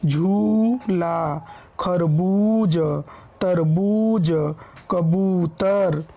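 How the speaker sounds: slow, stretched ū in झूला, खरबूज, तरबूज, कबूतर; long ū held. Shown as a demonstration. Teacher showing pupils the big ū, each one drawn out. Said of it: "क्रमशः देर तक होता है".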